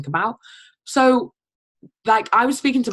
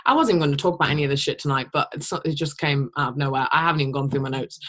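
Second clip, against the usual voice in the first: ordinary talk, nothing marked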